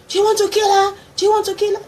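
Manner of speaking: high, loud, desperate scream. Retold or imitated. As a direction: shriek it at the father. Sister screaming at father.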